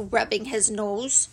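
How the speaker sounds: ordinary delivery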